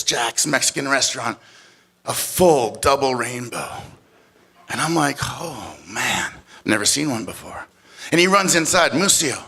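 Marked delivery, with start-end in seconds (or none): 5.2-7.7 soft, low wonder; hushed awe. Retold or imitated. Storyteller seeing a double rainbow, hushed awe. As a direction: breathe it out quietly, awestruck.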